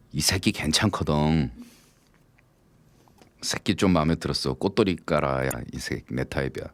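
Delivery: low, soft sly musing; a smug private aside; deer's inner thoughts. Retold or imitated. The deer sizing up the hunter, quietly.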